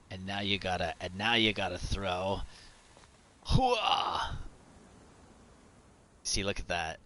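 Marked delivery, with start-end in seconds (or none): 3.5-4.4 hooah battle cry, high and loud; loud gung-ho soldier. Pretend. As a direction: bark it like a pumped-up soldier. Throwing the smoke grenade.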